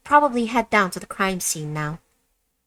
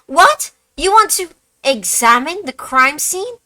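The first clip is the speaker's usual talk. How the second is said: high, loud incredulous cry; shocked disbelief; 11 semitones higher, 8 dB louder than usual. Acted. Officer balks at the detective's request, loud and disbelieving. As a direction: recoil at the outrageous request.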